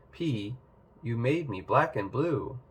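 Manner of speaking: plain reading voice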